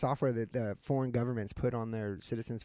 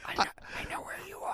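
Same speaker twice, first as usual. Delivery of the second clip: fast, breathy stalker whisper; creepy whisper; 7 dB quieter, faster than usual. Pretend. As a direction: whisper it like a spooky phone stalker.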